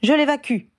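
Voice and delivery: crié haut et fort à la cantonade; cri. A narrator's reading. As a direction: lance l'ordre à pleine voix. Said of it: "cria Harry à la cantonade". (Harry crie à tous qu'il l'évacue)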